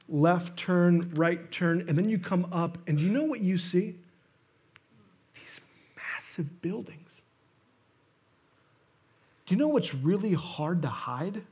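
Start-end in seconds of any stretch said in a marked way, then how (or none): 5.3-7.0 awed whisper. Acted. Dramatic reveal in story.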